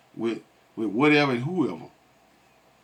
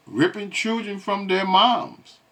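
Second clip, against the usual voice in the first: higher and slow, a hint of her crying; mock tearful; 6 semitones higher, slower than usual. Host mocking the crying mother's words, slow and higher. Echoing the crying woman's line.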